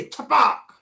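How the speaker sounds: high, loud imitated splash. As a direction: throw out the splash sound. Splash heard after man leaves. (ऊंची, जोरदार छपाक की आवाज़)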